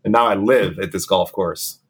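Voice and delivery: ordinary talk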